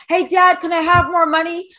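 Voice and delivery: mock spoiled son, pitched high; whiny rich kid. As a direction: beg dad for cash like a brat. Mocking a rich millennial son.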